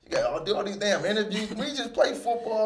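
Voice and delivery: griping to reporters about endless interviews, pitched high; exasperated complaint. Retold or imitated. Complaining about Super Bowl interviews.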